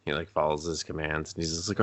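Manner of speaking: ordinary talk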